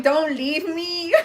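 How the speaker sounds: high, drawn-out mock plea; worried pleading. Officer silently begging the sheriff to stay, worried. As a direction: beg half-laughing, stretching the words. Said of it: "this worried face on me".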